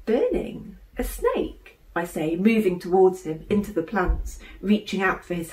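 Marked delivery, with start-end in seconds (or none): 0.0-1.8 high, laughing questions; amused disbelief. A narrator's reading. Sister stifling laughter at her brother's snake claim, amused. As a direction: hold back a giggle at his drama. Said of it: "Why are you laughing?".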